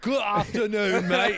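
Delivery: slow, high mock English accent. Friend teasing with slow put-on English accent. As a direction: stretch out the English greeting. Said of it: "sound like a Peaky Blinder".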